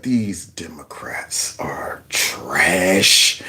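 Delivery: very slow, low, hushed creak; imagined inner thoughts. Retold or imitated. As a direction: imitate his silent contempt, softly dragging each word. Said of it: "in his mind, he's like".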